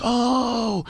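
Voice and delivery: a yelp of shock after jumping off; startled oh. Retold or imitated. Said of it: "I was like".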